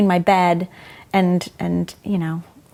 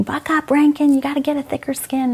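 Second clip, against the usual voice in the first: retold scream; 6 semitones higher than usual. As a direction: bark it like a harsh male teacher.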